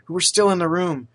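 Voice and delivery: ordinary delivery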